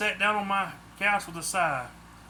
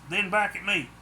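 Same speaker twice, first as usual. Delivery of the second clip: usual speaking voice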